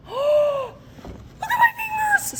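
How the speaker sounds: high, thrilled look at my fingers; a real, breathless burst of delight; excited gasp. Real, not acted. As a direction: squeal it out in amazement, high and loud. Gasps at returned hydration.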